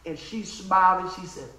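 normal talking voice

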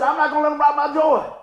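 half-mad vow not to lose his joy; mad declaration. Retold or imitated. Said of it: "I started out being mad when I said it".

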